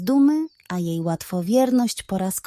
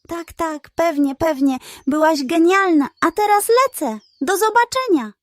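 nervous hurried pug; 7 semitones higher, 3 dB louder than usual. Acted.

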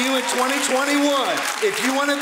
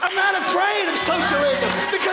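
higher, shouted preacher's cry; fiery preaching; 9 semitones higher than usual. Acted. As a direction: proclaim it without fear. Preaching at full force.

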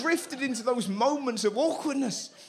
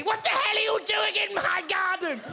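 put-on little old lady finding a man in her garden; old lady shouting; 12 semitones higher than usual. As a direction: screech like an outraged old lady. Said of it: "some little old lady".

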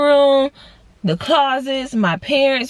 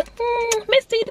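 high, sing-song call for the cat; 13 semitones higher, slower than usual. Retold or imitated. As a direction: coax the cat out, pitched up high. Calling the hiding cat.

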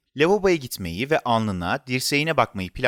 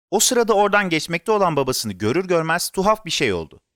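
ordinary delivery, nothing marked

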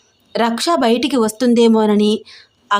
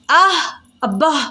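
pain yelp; 5 semitones higher than usual. Acted. Rocky bitten on the finger, yelping in pain. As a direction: cry out when bitten.

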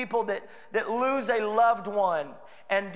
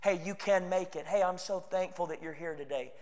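soft encouragement; 3 semitones lower, 7 dB quieter than usual. Retold or imitated. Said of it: "comes alongside of you and says".

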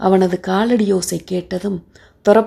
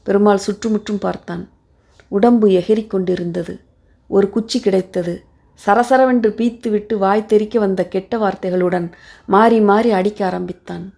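plain reading voice

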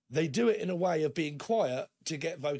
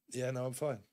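lower, softer and flat; sulky flat reply; 3 semitones lower, 4 dB quieter than usual. Retold or imitated. The sulking friend brushing you off, low and flat. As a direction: hold it back like you're hiding a sulk. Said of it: "But this person's kinda like".

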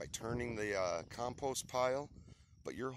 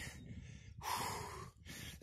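long unvoiced exhausted sigh; breathless sigh; 7 dB quieter than usual. Real, not acted. Gardener exhausted after pitchforking compost, heavy sigh. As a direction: blow out a tired breath. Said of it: "man, I'm winded".

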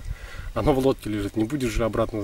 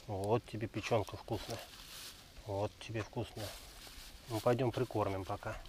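tender dog talk; gentle coaxing; 14 dB quieter than usual. Real, not acted. Ласково с собакой. (ласково и тихо)

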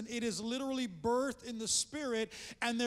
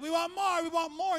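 loud, higher, greedy clamoring; demanding whine; 6 semitones higher, 6 dB louder than usual. Acted. Preacher voicing people who always want more, loud greedy clamor. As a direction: cry out like a spoiled child wanting more.

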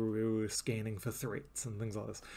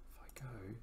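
whisper; 15 dB quieter than usual. Retold or imitated. Scheming aloud over gnome theory.

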